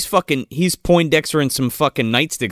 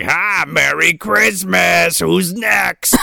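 slow gloating villain voice; mock menacing Santa; 4 semitones higher, much slower than usual. Killer Santa on the windshield, slow and gloating. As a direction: drag it out with glee. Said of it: "He's like".

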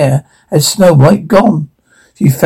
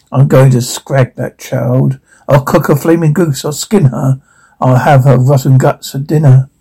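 ordinary delivery

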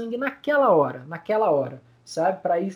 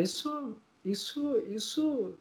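choked up; 3 semitones higher, 10 dB quieter, slower than usual. Real, not acted. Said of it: "eu já tô chorando mesmo".

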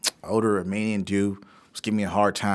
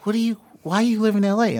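much higher imitated little boy; kid voice; 13 semitones higher, 6 dB louder than usual. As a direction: pitch up to play the boy. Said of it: "the little boy's like".

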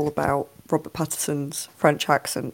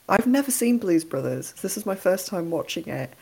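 usual speaking voice

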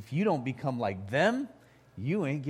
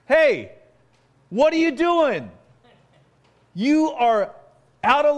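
much higher and louder, scolding the disciples; indignant rebuke; 11 semitones higher, 9 dB louder than usual. Retold or imitated. Pastor voicing Jesus rebuking the disciples, loud and indignant. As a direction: call them out sharply, Jesus indignant. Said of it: "I don't think this was a soft-spoken word".

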